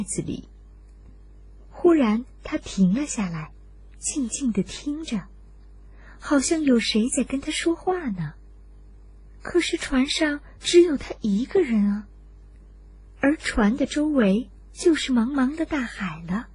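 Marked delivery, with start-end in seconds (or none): none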